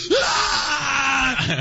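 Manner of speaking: a short hammed-up scream of fury; mock rage scream. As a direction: yell it like you're smashing the place. Retelling smashing the office.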